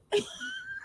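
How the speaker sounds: squealing shriek of laughter; high shrieking laugh. Real, not acted. Laughing fit.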